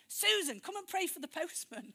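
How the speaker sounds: retold holler, pitched well up; imitated shout. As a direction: call out like a husband summoning her. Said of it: "my husband shouted".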